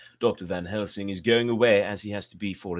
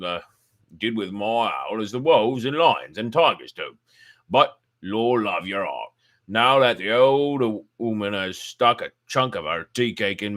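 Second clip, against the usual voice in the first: put-on louder, rough-spoken old keeper; old keeper talking shop over his pipe, loud and blunt; gruff old zookeeper; 7 dB louder than usual. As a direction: boom it out like a blunt working man. Keeper speaks in dialect.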